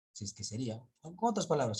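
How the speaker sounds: ordinary delivery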